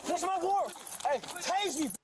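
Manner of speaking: high-pitched, furious dare; defiant yelling. Real, not acted. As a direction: dare them, voice pushed way up. Suspect defies officers with taser.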